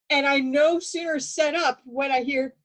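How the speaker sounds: ordinary talk, nothing marked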